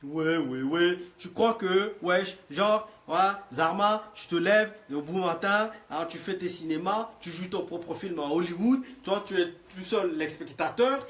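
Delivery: loud, high, mocking banlieue slang; banlieue French. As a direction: taunt him in street French slang. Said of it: "je te parle comme les racailles".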